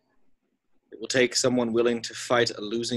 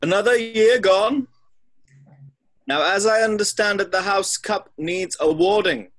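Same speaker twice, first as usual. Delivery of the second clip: louder and higher, slowing down toward the end; grand announcement; 8 semitones higher, 6 dB louder than usual. Acted. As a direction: proclaim it to the whole hall. Headmaster addresses the feasting hall.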